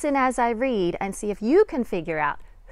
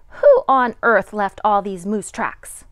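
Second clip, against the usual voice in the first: exclaiming; 10 dB louder, slower than usual. A narrator's reading. Climax of mystery refrain.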